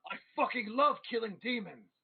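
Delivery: put-on high, excited boast from a drunk priest; rowdy drunken declaration; drunken exultation. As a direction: crow it out with rowdy glee, pitch swooping up. Drunk priest slams drink, exclaims.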